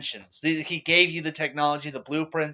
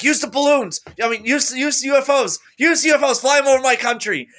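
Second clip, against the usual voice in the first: raised, high-pitched urging; urgent pleading shout; 9 semitones higher, 5 dB louder than usual. Retold or imitated. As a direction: cry it out as an excited plea. Retells urging the ministry.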